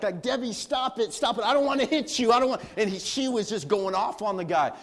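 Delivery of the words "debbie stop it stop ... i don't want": higher and louder, the bully begging her to stop; panicked bully. Retold or imitated. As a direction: plead with Debbie, higher and louder, losing the fight. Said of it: "he's like".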